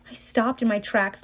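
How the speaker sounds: ordinary delivery, nothing marked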